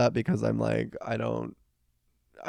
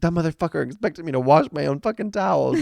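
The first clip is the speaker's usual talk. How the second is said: retold outraged rant, higher and louder; going off; 6 semitones higher, 5 dB louder than usual. Imitating her friend ranting to her about the towel request, outraged. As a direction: vent hotly about the towels. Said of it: "like, going off to me".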